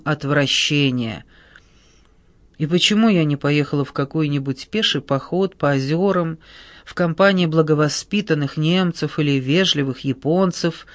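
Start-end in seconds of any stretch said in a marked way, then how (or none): none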